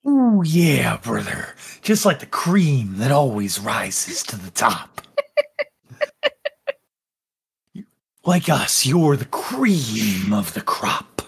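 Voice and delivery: fawning over the new guy, dropping to a hushed whisper; wrestler-ish flatterer. Acted. Prisoner voice continues.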